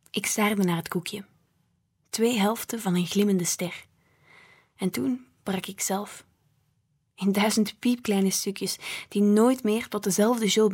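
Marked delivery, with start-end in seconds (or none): none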